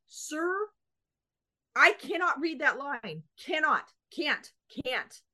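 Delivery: exasperated insistence. Retold or imitated. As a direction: insist you can't, near the end of your rope. Arguing with DMV clerk.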